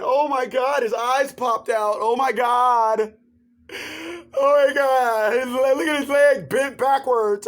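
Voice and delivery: imitating weeping woman. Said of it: "They start crying".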